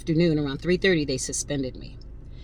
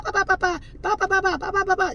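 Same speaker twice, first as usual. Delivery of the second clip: mock chatter; 14 semitones higher, faster than usual. Mocking the back-and-forth chatter.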